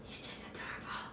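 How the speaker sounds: very soft, barely-there whisper; adoring whisper. Real, not acted. Doting owner about the puppy, in a hushed whisper. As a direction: whisper it tenderly. Murmuring fondly over the puppy.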